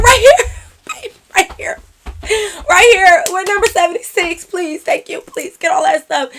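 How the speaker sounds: high, urgent mock yell; hammed-up relieved panic; frantic calling out. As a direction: flag the server down desperately. Acting out frantic answer to server.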